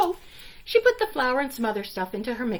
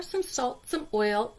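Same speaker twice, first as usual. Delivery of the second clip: ordinary delivery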